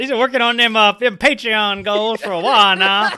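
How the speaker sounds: put-on country accent. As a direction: lay the Georgia accent on thick. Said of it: "You sound real good".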